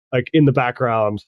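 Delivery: normal talking voice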